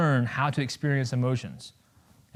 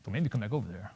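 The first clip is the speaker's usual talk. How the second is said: grumbling dog; 6 semitones lower, 12 dB quieter, faster than usual. Retold or imitated. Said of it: "The dog would be like".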